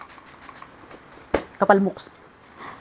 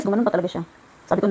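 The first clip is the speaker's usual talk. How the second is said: ordinary talk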